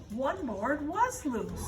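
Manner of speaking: plain reading voice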